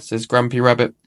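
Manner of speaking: ordinary delivery, nothing marked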